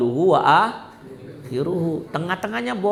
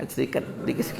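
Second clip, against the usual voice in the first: quiet aside; 4 semitones lower, 7 dB quieter than usual. Shown as a demonstration. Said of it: "Ada cerita dikit nih ya".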